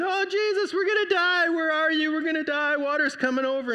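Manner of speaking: high, loud terrified cry for help; panicked screaming. Retold or imitated. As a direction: scream it like the boat is sinking. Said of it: "he's screaming".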